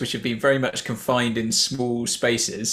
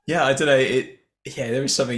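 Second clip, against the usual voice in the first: ordinary delivery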